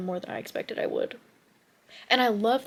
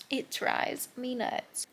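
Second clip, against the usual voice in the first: exasperated; 4 semitones higher, 4 dB quieter, much slower than usual. Real, not acted. Frustrated vent.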